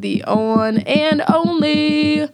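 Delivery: "on and only" stretched long, high and almost sung; dramatic emphasis. Real, not acted. Emphatic dramatic story build-up.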